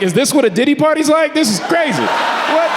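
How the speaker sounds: stunned passenger thinking aloud in disbelief; incredulous. Retold or imitated. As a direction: think it in shocked panic. Inner thought while groped.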